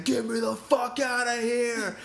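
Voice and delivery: high, slow, strained mock yell; hammed-up drunk prisoner yelling; retold scream. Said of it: "pounding on the plexiglass and screaming and saying".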